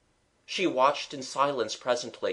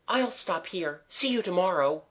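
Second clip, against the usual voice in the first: higher-pitched, lightly winded farewell; mild gasping; 6 semitones higher than usual. A narrator's reading. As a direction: say it a touch out of breath. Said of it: "gasped Mabel".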